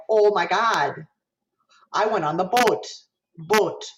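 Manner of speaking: Minnesota accent with pure, held O. Shown as a demonstration. Teacher showing the pure O vowel, Minnesota accent. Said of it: "Minnesota accent".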